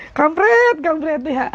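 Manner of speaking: very high, laughing mock curse; umpatan bercanda. Real, not acted. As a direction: squeal it through laughter. Laughing swear at a comment. (mengumpat sambil tertawa)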